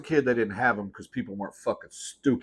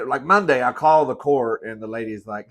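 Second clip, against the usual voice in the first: ordinary talk, nothing marked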